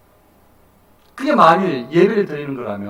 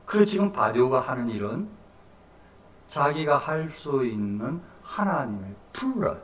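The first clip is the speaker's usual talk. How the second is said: ordinary talk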